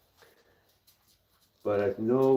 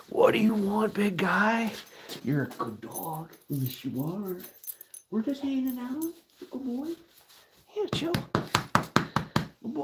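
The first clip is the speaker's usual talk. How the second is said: pet talk; 11 semitones higher, 4 dB quieter than usual. Real, not acted. Soothing his dog.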